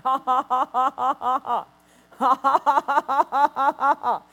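loud hearty ha-ha laughter, imitating a preacher; retold laughing fit. A preacher retelling how he laughed at the attack, hearty laughing. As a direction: laugh it off like the symptoms are nothing. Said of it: "I just started laughing".

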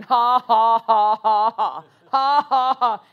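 loud, forced "ha ha ha"; put-on laugh. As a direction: laugh deliberately in defiance, each ha distinct. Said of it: "I put that laugh on".

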